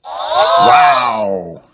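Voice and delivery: high, loud amazed wow. Acted. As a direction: gasp it out in wonder. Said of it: "says everyone".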